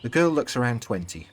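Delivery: usual speaking voice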